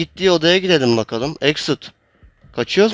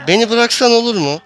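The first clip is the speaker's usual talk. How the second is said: pleading; 9 semitones higher than usual. Real, not acted. Begging the chaser in game.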